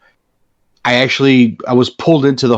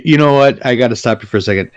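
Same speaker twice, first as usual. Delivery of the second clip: usual speaking voice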